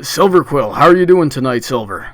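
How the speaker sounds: usual speaking voice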